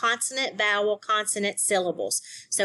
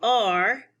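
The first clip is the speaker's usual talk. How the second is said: usual speaking voice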